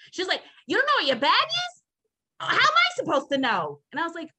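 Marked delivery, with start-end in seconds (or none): 0.7-3.8 mock snippy clerk, much higher pitch; rude clerk. Retelling the airport clerk snapping at her, mock high snippy voice. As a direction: imitate a rude baggage clerk.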